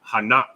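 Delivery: Hanak as two syllables, ha-NAK; mistaken run-together form. Shown as a demonstration. Teacher showing what we'll wanna say, Hanak run together. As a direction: end Hanak on k with no vowel after. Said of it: "we'll pronounce it like".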